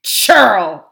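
loud, outraged insult; king's forceful rebuke; indignant cry. A narrator's reading. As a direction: cry out in affronted anger. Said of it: "cried Tyrion".

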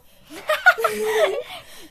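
high, loud burst of laughter. Real, not acted. Cracking up at a friend's fairy tale, laughing.

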